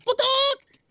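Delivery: very high squawked "Bakak"; chicken squawk. Retold or imitated. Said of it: "'cause that's a funny sound".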